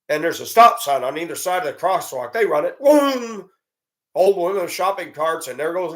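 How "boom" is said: high, loud burst of mock impact; mock crash. Telling listeners about reckless drivers, loud mock impact. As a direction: blurt the crash sound.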